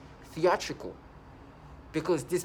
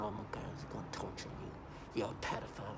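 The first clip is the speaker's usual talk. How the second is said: whispered, much softer mock threat; mock man's whisper; 6 semitones lower, 13 dB quieter than usual. Imitating a man who taunts her, a whispered mock threat. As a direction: hiss it like a sneaky taunting man. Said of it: "some man try to sound".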